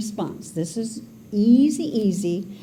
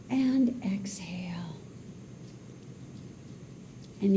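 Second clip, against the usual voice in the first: hushed meditation voice with whispered exhales; guided meditation; 7 dB quieter than usual. Acted. Leads a breathing meditation.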